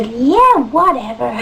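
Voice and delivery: loud, dismissive mock backtalk; attitude. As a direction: answer like a stroppy worker. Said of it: "they have a bit of an attitude".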